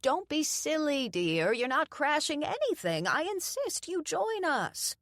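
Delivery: high, lightly voiced doting grandma; old woman insists she stay for dinner; insistent old lady. A narrator's reading. Doreen insists.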